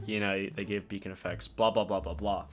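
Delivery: low, soft trailing recap; dismissive blah-blah. Retold or imitated. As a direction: skim over your own explanation, dropping low. Skimming over own explanation.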